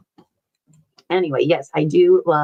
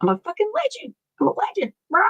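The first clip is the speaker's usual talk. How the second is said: high squawky parrot impression, much higher; imitating Eric the parrot; 13 semitones higher, faster than usual. Streamer mimics the parrot Eric bragging. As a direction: squawk it out like a cocky bird. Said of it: "'cause he'll be like".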